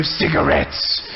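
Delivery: a mock solemn warning to a kid; deadpan grim answer. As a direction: drop the voice way down and say it grave. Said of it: "and I go".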